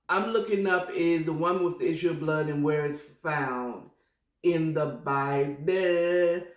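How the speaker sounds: normal talking voice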